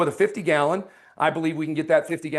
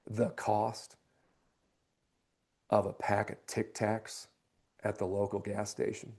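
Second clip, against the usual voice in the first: serious down tone; 6 semitones lower, 8 dB quieter than usual. Shown as a demonstration. Salesman closing with homeowner on the price, soft and serious. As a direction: lower the voice and ask it earnestly. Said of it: "I go into more of a down tone".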